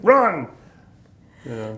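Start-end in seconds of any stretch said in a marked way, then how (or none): 0.0-0.5 sharp mock drill sergeant yell, much higher and louder; barked order. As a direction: bark the order to run.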